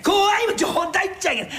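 boastful handyman scoffing at his master; indignant scoff. Acted. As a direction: brush off the very idea of fear. Tora scoffs at fear.

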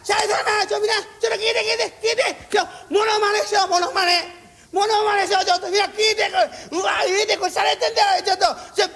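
high, giddy begging to be heard; giddy drunk master; drunk master pleading. Retold or imitated. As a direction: play a tipsy old master tugging at everyone.

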